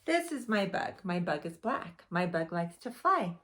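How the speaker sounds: ordinary talk, nothing marked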